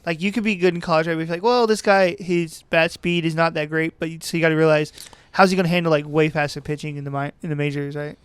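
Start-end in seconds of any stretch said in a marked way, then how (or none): none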